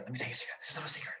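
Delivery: soft unvoiced secret whisper. Shown as a demonstration.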